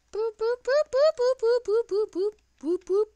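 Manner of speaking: high silly boop noises, rising then falling; squeaky boops. Pretend. Gamer playing around, high squeaky boops. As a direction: bounce out cartoon boops.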